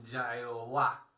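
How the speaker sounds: Yaehová drawn out slowly and loud; phonetic reading. Shown as a demonstration. Preacher shows how the Tetragrammaton could be read, Yaehová sounded out slowly. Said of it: "fonéticamente leerse".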